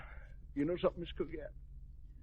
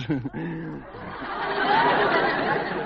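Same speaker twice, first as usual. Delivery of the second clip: hearty laugh; 3 semitones lower, 6 dB louder than usual. Acted. General laughs at his own flirting.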